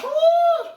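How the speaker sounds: high, loud whoop of joy; a real cheer that shoots up high. Real, not acted. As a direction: let out a joyful cheer. Cheering during shout-outs.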